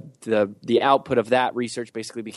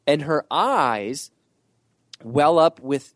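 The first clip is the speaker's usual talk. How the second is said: ordinary talk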